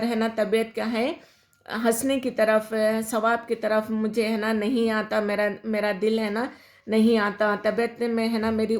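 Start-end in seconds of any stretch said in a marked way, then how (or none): none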